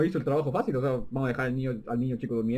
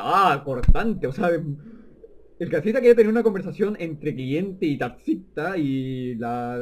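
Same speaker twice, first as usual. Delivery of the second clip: loud mock outrage; 6 dB louder, much slower than usual. Real, not acted. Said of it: "mocking commentary".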